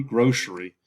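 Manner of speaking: grocery said as "groshery", sh in the middle. Shown as a demonstration. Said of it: "Has a sh sound in there".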